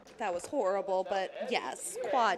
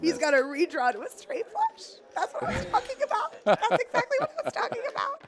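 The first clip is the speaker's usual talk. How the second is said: high-pitched, laughing excitement; excited. Real, not acted. Commentator cheering a redraw to a straight flush, excited. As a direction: crow with delight, pitch leaping up.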